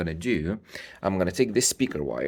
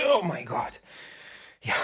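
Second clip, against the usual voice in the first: usual speaking voice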